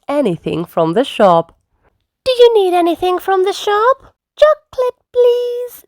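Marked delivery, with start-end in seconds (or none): 2.2-4.1 high storybook character voice; shopper's offer. A narrator's reading. Calling to neighbours' houses, high offering voice. 4.4-5.8 put-on very high little child voice; Susie Sheep. A narrator's reading. Lamb ordering from the shop, very high child voice. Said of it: "Susie Sheep said".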